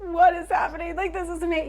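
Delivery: high, loud giddy exclamation; giddy amazement. Retold or imitated. Woman to her friend, reliving the giddy moment, high excited exclamation. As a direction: burst out in giddy disbelief. Giddy shared astonishment retold.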